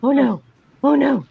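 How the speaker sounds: high, repeated mock-horrified moan; losing her mind. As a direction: relive mortified dread, each phrase set apart. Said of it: "like I was losing my mind".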